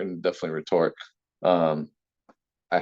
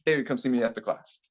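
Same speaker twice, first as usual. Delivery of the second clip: ordinary talk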